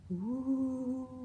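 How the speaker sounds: high howling wind sound; spooky wind ooh. Acted. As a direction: howl like the wind. Wind sound in finger play.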